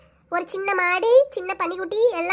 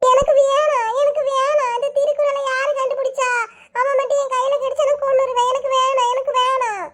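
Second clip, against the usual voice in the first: high, wailing protest; crying tantrum; 6 semitones higher than usual. Acted. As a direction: wail the refusal in a high voice. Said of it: "என்ன இப்படி அழுறான்?". (அழுது அடம்பிடித்து கத்தும் குரல்)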